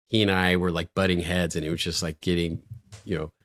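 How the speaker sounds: ordinary talk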